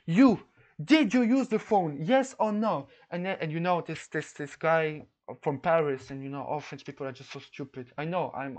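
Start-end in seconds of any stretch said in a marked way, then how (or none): 0.1-2.9 louder, higher demanding questions; retold stern interrogation. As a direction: press the suspect for an answer. Club president interrogates the opponent.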